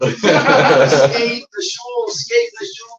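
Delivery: high, loud, very slow laughing repeat; amused teasing repeat; laughing mock. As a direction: chuckle the phrase out in delight.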